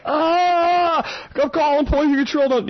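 very high, strangled fake panic; mock gagging panic. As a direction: ham up a poisoned man choking. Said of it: "gagging or whatever".

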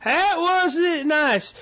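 high, slow, mock-hurt whine; slow mock complaint from offended listeners; mocking whiny listener. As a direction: whine it like a scolding fan, drawn out. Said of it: "I know I'm gonna hear from some of you guys".

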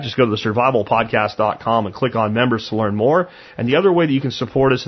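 usual speaking voice

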